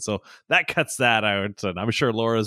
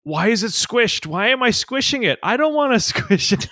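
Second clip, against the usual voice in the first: put-on high, whiny toddler wail, much higher; kid's meltdown; 13 semitones higher, 5 dB louder than usual. Dad imitating his son's squishing meltdown in a high whine. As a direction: play the little boy melting down over the squished bead. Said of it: "has started having a meltdown".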